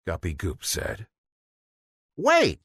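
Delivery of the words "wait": high sharp call to stop; a quick urgent shout; yelled. A narrator's reading. Flounder yells to stop Guppy Goop, a high urgent call. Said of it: "yelled Flounder".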